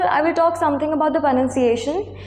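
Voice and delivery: ordinary talk, nothing marked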